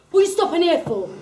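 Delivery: rushed hostile shout; aggressive challenge. Acted. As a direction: confront the cyclist quickly. Aggressive stranger voiced in story.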